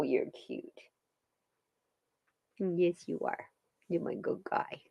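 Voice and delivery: soft whispered praise; whispered pet praise. Real, not acted. Praising the dog in a whisper.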